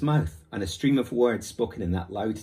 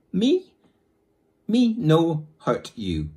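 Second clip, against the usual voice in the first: louder, slow, high pidgin; mock broken English; 8 semitones higher, 8 dB louder, much slower than usual. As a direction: talk slow and simple like to a foreigner. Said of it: "in what he supposed was Indian English".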